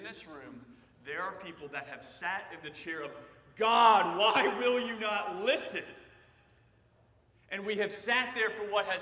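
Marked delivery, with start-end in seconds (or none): 3.6-5.8 a loud, drawn-out cry of why; desperate plea. Retold or imitated. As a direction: plead with God, slow and loud. Voicing congregation's prayer.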